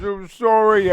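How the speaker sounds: put-on voice of a sorry teammate, a bit loud; mock mumbled apology. Commentator imagining Matt's words, mock mumbled apology. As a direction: imitate a sheepish mumble, hammed up. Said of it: "He probably mumbled the words".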